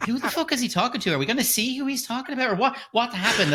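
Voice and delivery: high-pitched baffled demand; confused outrage. Retold or imitated. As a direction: squeak out the question in total confusion. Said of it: "and I'm going".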